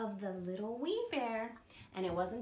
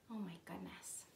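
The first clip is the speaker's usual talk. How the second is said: whispered storyteller's dismay; hushed shock; 4 semitones lower, 12 dB quieter than usual. A narrator's reading. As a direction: whisper it as if scandalised. Mock shock told to children.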